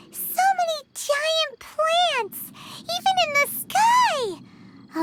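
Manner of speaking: high, drawn-out wonder; amazed. Acted. Traveler's companion marvels at giant plants in the dream, amazed. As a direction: stretch it out in awe, pitch soaring.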